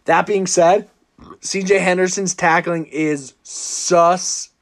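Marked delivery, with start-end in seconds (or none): 2.8-4.5 "suspect" split in two by a pause; emphatic SUSPECT. Real, not acted. Host conceding a colleague's point about tackling, "suspect" slowly broken up. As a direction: draw out "suspect", landing each syllable separately. Echoing colleague's word for effect.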